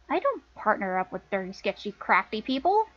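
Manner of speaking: questioning denial. Acted. Lana denying her sister's accusation, questioning echo. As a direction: echo the charge back, ending as a question.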